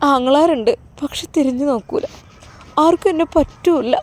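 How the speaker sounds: forlorn, halting confession; acted hurt, voice thinning away; tearful. Crying woman tells of being unwanted. (സങ്കടത്തോടെ, കരച്ചിലടക്കി)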